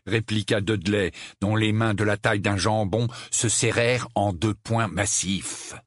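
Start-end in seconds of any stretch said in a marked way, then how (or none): none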